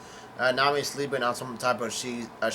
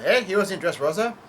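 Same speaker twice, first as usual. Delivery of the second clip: higher and louder, a mock yell of surprise; retold yell; 6 semitones higher, 4 dB louder than usual. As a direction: call it out like Luffy, half-acted. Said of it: "Luffy yells out".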